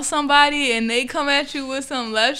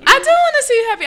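emphatic protest; 10 semitones higher, 4 dB louder, faster than usual. Real, not acted. Protesting friend's accusation.

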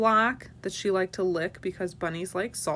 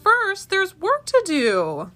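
much higher, slow and firm; firm mama rabbit; 11 semitones higher, 5 dB louder, slower than usual. A narrator's reading. Mama rabbit tells her kids work comes first, high and firm. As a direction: set the rule kindly but firmly. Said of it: "their mama said".

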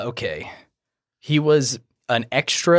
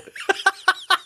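high laugh. Real, not acted. Laughing after impression.

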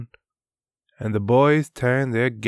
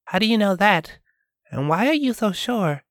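higher, pressing questions; a woman questioning the girl, higher voice; doubtful young woman; 11 semitones higher, faster than usual. Acted. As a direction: challenge her gently, pitched up. Diana voiced in story.

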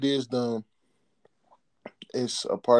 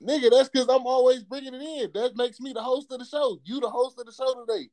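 high-pitched, heated protest; excited; 11 semitones higher than usual. Real, not acted. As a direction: push it up high, insisting. Playful argument over who hosts.

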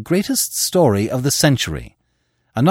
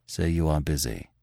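much softer menacing order; hushed command behind a gun; low looming threat; 4 semitones lower, 7 dB quieter than usual. A narrator's reading. Gunman orders editor quietly.

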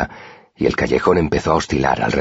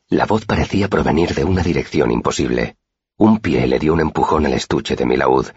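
usual speaking voice

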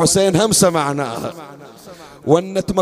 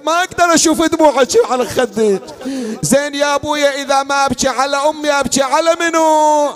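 higher, rushed wailing plea; high mournful wail; grieving cry; 8 semitones higher, slower than usual. Acted. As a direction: cry out as Zaynab in grief.

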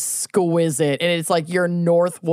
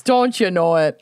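put-on Minnesotan accent. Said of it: "their worst Minnesotan accents".

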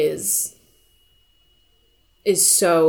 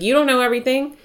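put-on higher and louder, indignant old man; defiant retort; 8 semitones higher, 4 dB louder, faster than usual. Old father snapping at his nurse, indignant. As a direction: snap back like a stubborn, confused father.

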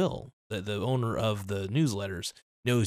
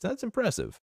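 sarcastic; 12 semitones higher than usual. Real, not acted. Sarcastic praise of pump-and-dump trader.

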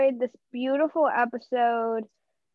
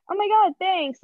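mildly gushing gift reaction, pitched up; extravagant reaction; 7 semitones higher, 3 dB louder than usual. Acted. Acting out receiving a gift, lightly gushing. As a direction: act out a lightly over-the-top thank-you. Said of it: "really, like, extravagant reaction".

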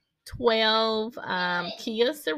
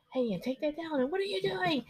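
imitating a sudden snap at the kids, higher and softer; mock sharp mom; 8 semitones higher, 5 dB quieter than usual. Daughter mimicking mom who switches from calm to scolding, mock snap. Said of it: "you be like".

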